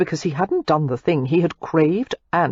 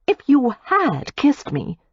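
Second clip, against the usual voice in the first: higher, slow, pointed retort; Daisy retorting; 8 semitones higher, slower than usual. A narrator's reading. Daisy retorts to Matthew, slow and pointed. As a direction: throw it back at him slowly. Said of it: "Daisy retorted".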